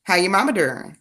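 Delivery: down-home Southern hello; put-on folksy check-in on the family; countrified greeting with pauses between questions; Badia voice. Shown as a demonstration. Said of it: "I do use my Badia voice".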